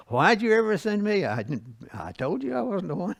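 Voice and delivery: mock-whine it like a griping kid; whiny complaint. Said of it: "Here he goes again".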